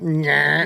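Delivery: short mock creak of a back going out; imitated back twinge. Coach's back went bending over, imitated twinge noise. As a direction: make a quick nasty twinge noise.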